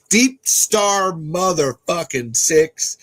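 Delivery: drawn-out hype announcement; hyped title shout. Real, not acted. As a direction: belt out the title like a trailer. Announcing a movie title loudly.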